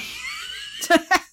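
high bright burst of laughter. Real, not acted. Laugh after joke.

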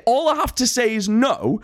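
normal talking voice

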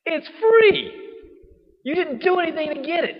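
high, loud disbelief that anyone would complain; incredulous exclamation. Real, not acted. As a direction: exclaim it's free in astonishment. Preacher mocking the ungrateful complaint.

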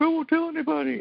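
high squeaky promise, hammed-up fear; mock pleading. Retelling the man begging the gargoyle, in mock high-pitched fear. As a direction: swear it in a panicked high voice.

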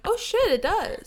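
high, loud sudden "oh shit"; surprised. Retold or imitated. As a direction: blurt it out, caught off guard. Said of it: "and I was like".

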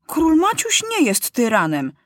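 high-pitched, emphatic objection; indignant boy's contradiction; lively denial. A narrator's reading. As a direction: protest quickly on the king's behalf. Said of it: "żywo zaprzeczył Maciuś".

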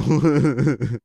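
very loud, high laughing fit. Real, not acted. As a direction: burst out laughing hard. Laughs after sequel joke.

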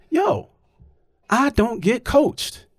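slow, high-pitched retold objection; indignant protest. Player confronting the umpire over coaching, retold indignant protest.

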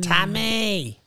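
very high, stretched north side "Ta-may"; hammed-up north side Tammy; north side accent. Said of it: "Tammy would be Tammy".

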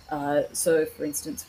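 ordinary talk, nothing marked

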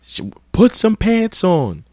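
high, slow mock scolding; mocking the guy. Guy on the bus scolds the girl, high mock scolding. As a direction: scold her in a squeaky man's voice. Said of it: "he gonna say something like".

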